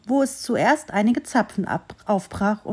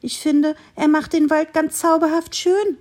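higher, louder enthusiastic little tree; cheerful tree calling; 7 semitones higher, 4 dB louder than usual. A narrator's reading. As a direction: call out the beauty of the snow. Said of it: "rief das Bäumchen". (fröhlich rufendes Bäumchen, höher und lauter)

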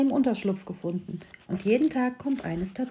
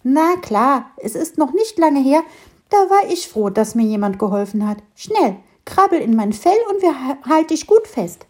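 squirrel; 5 semitones higher, 5 dB louder than usual. Acted. Squirrel reassuring ladybird.

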